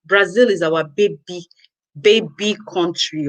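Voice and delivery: slow it down to hammer the point; slow emphatic. Real, not acted.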